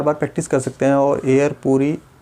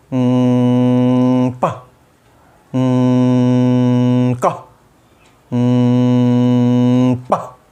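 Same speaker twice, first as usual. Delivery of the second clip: loud long hum buzzing in the nose, then a clipped consonant; nasal hum; 4 dB louder, much slower than usual. Shown as a demonstration. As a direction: hum hard into the nose before each syllable. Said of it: "आप नाक में vibration करोगे". (नाक में गूंजता हुआ हम्म)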